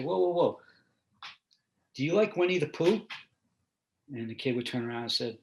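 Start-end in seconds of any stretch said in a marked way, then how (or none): 0.0-3.0 higher, louder pull-up; mock challenge. Stopping a kid in the lockup, mock challenge. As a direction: cut in with a raised, playful question.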